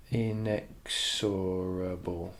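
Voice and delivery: very slow, syllable by syllable; drawn out. Shown as a demonstration. Teacher sounding out the word while writing it, drawn out. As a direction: stretch out each syllable of "inexorable".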